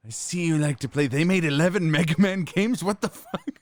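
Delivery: hammed-up psychic taunt breaking into laughter; Psycho Mantis voice. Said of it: "Psycho Mantis voice".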